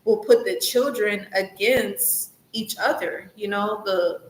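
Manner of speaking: usual speaking voice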